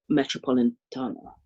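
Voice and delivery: usual speaking voice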